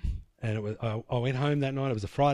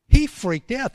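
much higher, louder punch; excited emphasis; 11 semitones higher, 8 dB louder, slower than usual. Real, not acted. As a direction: burst out with it for the crowd. Preacher emphasising the brother's shock.

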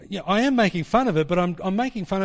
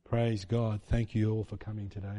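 low, hushed, mournful greeting; soft, sombre church voice, dropped low; mock funeral solemnity; 10 semitones lower, 9 dB quieter than usual. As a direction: welcome them as if someone died. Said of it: "it's like a funeral, like someone died".

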